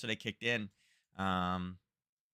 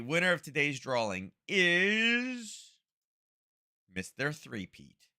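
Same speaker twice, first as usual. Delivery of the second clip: drawn-out, higher prize-show announcement; drawing announcer; 7 semitones higher, 6 dB louder, much slower than usual. Acted. Host to the livestream chat, announcing the drawing winner, game-show style.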